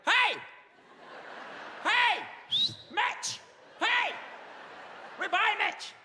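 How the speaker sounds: put-on short, high shouted bursts in Perthshire accent; shouting. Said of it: "I shout".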